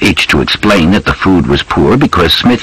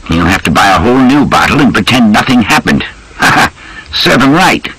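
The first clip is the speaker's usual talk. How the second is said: higher-pitched grumbling major, pleased with himself; British officer; 7 semitones higher than usual. Acted. Major boasting of a trap for his servant, high officer voice.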